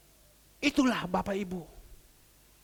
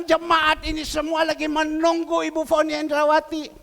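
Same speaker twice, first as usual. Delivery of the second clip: loud, insistent call to come; 5 semitones higher, 7 dB louder than usual. Retold or imitated. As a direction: ajak dengan lantang dan mendesak. Calls her to come to church. (memanggil lantang dan mendesak)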